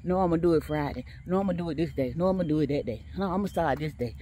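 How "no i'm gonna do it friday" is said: normal talking voice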